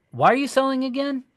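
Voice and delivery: high-pitched, innocently curious question; casual re-asking. Acted. Agent asking the seller halfway through, casually curious. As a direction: ask it lightly, pitched well up.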